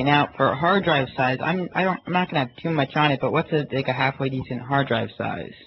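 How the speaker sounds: usual speaking voice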